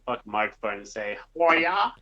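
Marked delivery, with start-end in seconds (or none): 1.3-1.9 loud hiya. Pretend. Shouted greeting into microphone.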